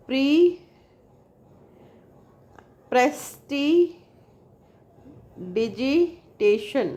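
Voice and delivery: prestidigitation very slow, each syllable held apart with long gaps. Shown as a demonstration. Teacher counting mouth openings for students, one drawn-out syllable at a time. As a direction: stretch out every syllable of prestidigitation. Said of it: "count how many times your mouth opens".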